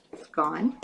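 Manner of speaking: normal talking voice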